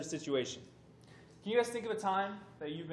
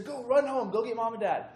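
raised, urgent call to his sister, higher and louder; a mock yell sending her running home; retold yell; 6 semitones higher, 6 dB louder than usual. Brother hanging from the hoop yells at his sister, raised urgent call. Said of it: "yells at my sister".

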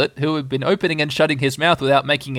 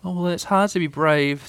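high, small plaintive voice; a mildly hurt little creature; slightly sniffing; 6 semitones higher than usual. A narrator's reading. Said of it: "sniffing slightly".

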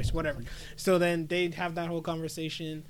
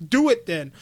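defiant dare; 5 semitones higher, 6 dB louder than usual. Retold or imitated. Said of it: "And she's like".

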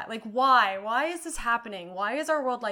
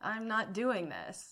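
quiet inner thought; 2 semitones lower, 9 dB quieter than usual. Retold or imitated. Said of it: "that's when I was like".

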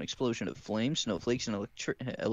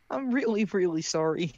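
embarrassed apology; 7 semitones higher than usual. Acted.

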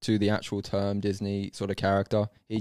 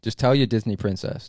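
ordinary delivery

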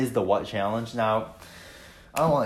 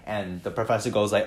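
ordinary delivery

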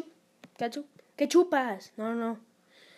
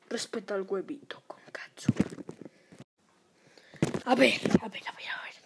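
whisper; 2 semitones lower than usual. Acted. Whispering to the audience about the egg. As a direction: whisper it so nobody hears.

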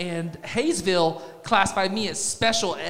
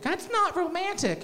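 high, soft mock wife; 11 semitones higher, 6 dB quieter than usual. Wife's reply voiced.

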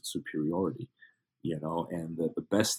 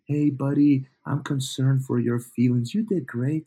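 higher, mock coddling sweetness; mock nice; 5 semitones higher, slower than usual. Mocking coaches who coddle a beaten student, mock nice. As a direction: pitch it up like you're soothing a baby. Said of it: "like, the nice".

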